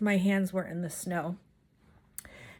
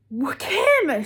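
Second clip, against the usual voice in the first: high, loud panicked mother calling her daughter; frantic mom; 7 semitones higher, 7 dB louder than usual. Retold or imitated. Mom spotting her missing daughter, frantic high loud cry. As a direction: burst out in a frantic mom's alarm. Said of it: "she is frantic".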